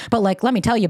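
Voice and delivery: normal talking voice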